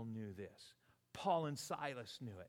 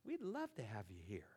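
whispered invitation; 3 semitones lower, 4 dB quieter than usual. Real, not acted. Inviting online viewers in softly.

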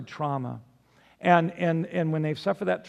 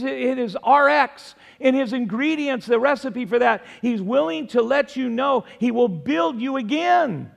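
louder, higher, driving preacher's delivery; full-force preaching; 7 semitones higher, 5 dB louder than usual. Real, not acted. Pastor promising the congregation God will rebuild them, full-force preaching. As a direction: build the promises one on another with force.

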